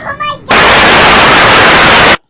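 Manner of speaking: long drawn-out scream. Real, not acted. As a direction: scream it slowly, with full force. Screaming at creepy scene.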